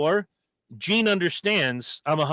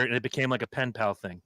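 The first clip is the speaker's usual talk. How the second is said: usual speaking voice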